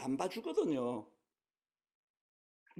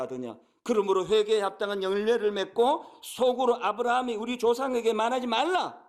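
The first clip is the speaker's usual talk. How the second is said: louder forceful Bible reading; scripture reading; 5 dB louder than usual. Acted. Pastor reading John's rebuke of the crowd aloud, forceful and loud.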